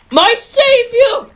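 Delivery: high, slow hammed-up cry of praise; mock worship. Player hails the teammate who saved him, hammed-up high cry.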